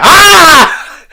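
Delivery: high, loud mock scream of fright; retold scream. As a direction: belt out a startled scream. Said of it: "he starts screaming".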